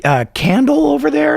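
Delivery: high, giddy retold amazement; amazed tripping friend. Tripping friend points out a hallucination to the others, amazed. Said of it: "they're laughing at, like".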